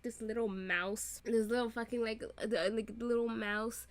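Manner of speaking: ordinary delivery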